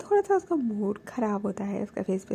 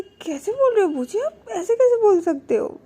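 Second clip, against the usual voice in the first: high, loud offended protest; indignant protest; 10 semitones higher, 7 dB louder than usual. Retold or imitated. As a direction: defend your partner indignantly. Baani protesting to Boochi.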